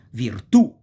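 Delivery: Virtù with a loud, punched final ù; stress on last syllable. Shown as a demonstration. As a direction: hit the end of Virtù: vir-TÙ. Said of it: "accent goes on the last syllable".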